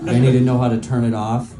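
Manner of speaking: usual speaking voice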